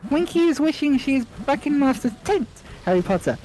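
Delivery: high timid elf squeak; small eager elf introducing herself; house elf voice. Acted. Winky greets Harry, high timid squeak.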